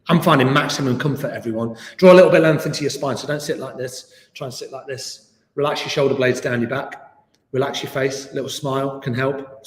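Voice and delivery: normal talking voice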